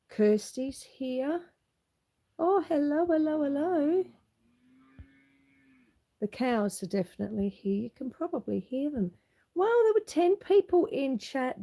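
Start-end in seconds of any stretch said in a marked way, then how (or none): none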